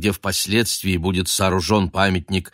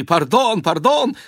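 flustered cry; 13 semitones higher, slower than usual. A narrator's reading. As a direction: cry out apologizing in panic. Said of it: "с криками".

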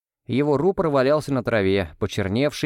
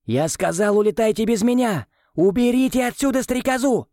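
high insistent commanding shout; 10 semitones higher than usual. A narrator's reading. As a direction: yell the order again, harder. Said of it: "но вслух опять крикнул". (громкий настойчивый крик)

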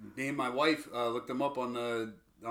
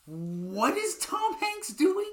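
much higher, slow incredulous question; baffled; 14 semitones higher, much slower than usual. Retold or imitated. Viewer baffled by Tom Hanks, high and slow. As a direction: drag it out in disbelief.

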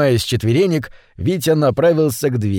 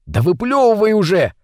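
high, insistent cry; mild shout; 7 semitones higher than usual. Acted. Митя orders his friend to spit out the fish, mild shout. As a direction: cry it out higher, demanding it now. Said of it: "крикнул Митя".

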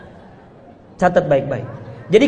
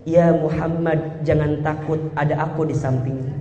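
tender whisper; 8 semitones lower, 7 dB quieter than usual. Retold or imitated. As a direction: whisper comfort into his ear. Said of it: "Dibisikin telinganya".

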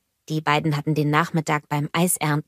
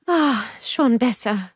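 slow, relieved, pitched up; Anna sighing with relief in the water; 6 semitones higher, slower than usual. A narrator's reading. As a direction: breathe out relief as feet hit water. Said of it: "seufzte sie".

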